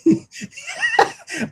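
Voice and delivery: cracking up hard; big laugh. Real, not acted. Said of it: "yours was better than mine".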